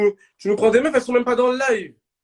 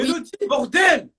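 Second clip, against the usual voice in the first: shouted call for Mélodie, exasperated; shouting; 7 semitones higher than usual. Real, not acted. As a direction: snap out her name. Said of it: "Pourquoi tu cries?".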